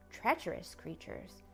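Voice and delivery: ordinary delivery, nothing marked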